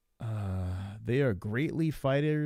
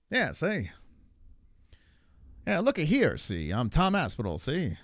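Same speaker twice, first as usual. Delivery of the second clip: Tom Aspinall; 3 semitones higher than usual. Acted. Streamer mocking how a fighter talks, exaggerated old-time broadcaster voice. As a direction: swoop up like a fifties broadcaster. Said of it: "why does he talk like that".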